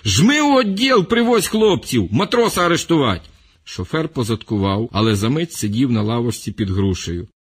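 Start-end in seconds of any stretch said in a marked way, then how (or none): 0.0-3.3 shouted orders. Acted. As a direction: bark orders down from the tree. Official orders from tree.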